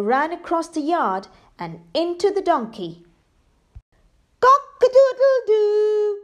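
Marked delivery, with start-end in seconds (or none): 4.4-6.2 high, loud, slow screeched crow; loud slow rooster screech. Acted. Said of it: "screeched the rooster".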